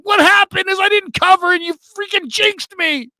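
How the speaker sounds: very high, loud exasperated outburst; frantic rant. Retold or imitated. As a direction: blame him for the jinx, losing it. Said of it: "you just hear me go bananas".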